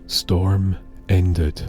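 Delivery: usual speaking voice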